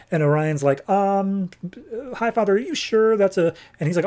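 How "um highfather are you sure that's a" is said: high, tentative acted voice; hesitant questioning. Orion dares to question Highfather, hesitant and tentative. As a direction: imitate a nervous, halting query. Son timidly questions his father's order.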